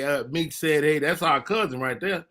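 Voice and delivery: ordinary talk, nothing marked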